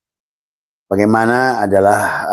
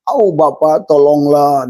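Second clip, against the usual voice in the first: high, pleading whine to a father figure; whining plea; 6 semitones higher, slower than usual. Shown as a demonstration. Preacher mimics a believer begging a person for help, in a high whine. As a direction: beg like a whimpering child. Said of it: "dengan bahasa rengekan".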